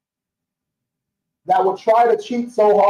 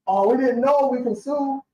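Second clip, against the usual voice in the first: higher voice, mock-helpless; mock whiny complainer; 6 semitones higher than usual. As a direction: mimic clueless clients whining. Mocking complaining customers.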